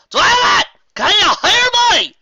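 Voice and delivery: high, loud shout, slow; shouting Applejack. Acted. Applejack shouts into the headset again, high and loud. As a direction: bellow into the mic.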